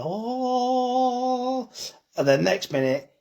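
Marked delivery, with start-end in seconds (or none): 0.0-1.7 high, drawn-out oh; a hint of someone coming back to life; revived gasp. Butcher mimics the vegan daughter revived by steak, high oh. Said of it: "she'll be like".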